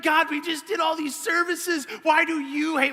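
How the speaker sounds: high, hammed-up complaint to heaven; over-the-top pleading. Pastor complaining to God after services, over-the-top pleading. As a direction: plead upward in mock despair. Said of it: "I was just over top".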